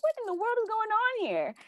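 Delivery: high, baffled disbelief; bewildered. Retold or imitated. Baffled speaker realising the deja vu, in high disbelief.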